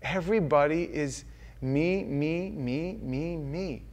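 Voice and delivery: ordinary delivery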